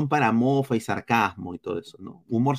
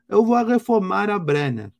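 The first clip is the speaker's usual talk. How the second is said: quoting in Portuguese, a bit higher; Portuguese quote; 6 semitones higher than usual. Retold or imitated. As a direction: mimic the coach's Portuguese line. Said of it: "Y así dijo".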